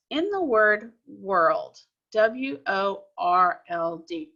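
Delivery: slow, drawn-out reading of the word "world"; slow demo reading. Shown as a demonstration. Teacher drawing out "world" slowly for the class. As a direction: read it slowly so the class can hear each sound. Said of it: "let me show you what I'm talking about".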